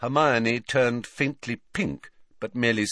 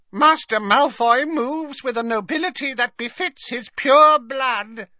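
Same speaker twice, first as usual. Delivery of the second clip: high, reverent creature croak, loud on the last words; croaking house elf; 6 dB louder than usual. A narrator's reading. House elf praising a pure-blood master, croaking high and loud. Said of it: "croaked Creature at once".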